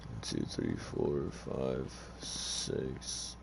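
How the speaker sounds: very quiet, low count under the breath; hushed self-directed counting; counting to oneself. Real, not acted. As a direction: count softly to yourself, barely voiced. Counting slots to himself.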